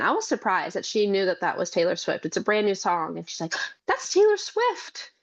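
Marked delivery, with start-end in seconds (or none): 3.9-5.1 put-on little girl voice. Mother quoting her small daughter.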